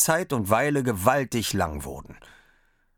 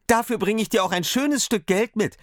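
high, bright little voice; Daumerling speaking; 13 semitones higher, 4 dB louder than usual. A narrator's reading. Daumerling offers his father a coin, high. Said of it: "sprach der Daumerling".

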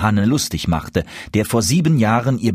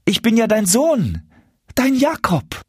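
high-pitched appeal, broken by a pause; earnest pleading; 13 semitones higher than usual. A narrator's reading. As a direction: implore your mother to recognise you. Son begs mother to recognise him. (flehentlich, mit hoher Stimme)

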